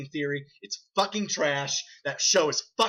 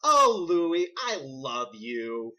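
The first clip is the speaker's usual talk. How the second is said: drawn-out, hammy sitcom wife; mock-adoring wife line; imitated sitcom wife; much slower than usual. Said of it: "She's like".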